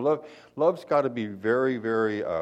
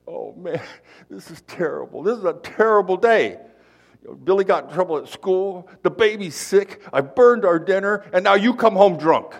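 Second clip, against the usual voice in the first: high, loud mock wail building to a yell; hammed-up tearful tirade at the husband; bawling wife; 10 semitones higher, 9 dB louder than usual. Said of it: "she starts bawling her head off".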